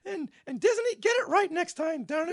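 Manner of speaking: very high cartoon mouse voice scolding; squeaky Mickey telling Disney off; high, mock-indignant squeak. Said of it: "Mickey joined us".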